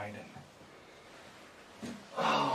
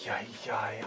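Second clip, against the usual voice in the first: usual speaking voice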